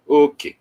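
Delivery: Okay with the first syllable high and the second low; high-low tones. Shown as a demonstration. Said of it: "High, low".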